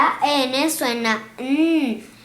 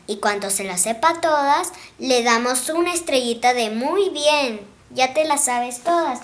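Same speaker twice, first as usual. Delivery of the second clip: fast talk; much faster than usual. Real, not acted. Explaining fast to camera.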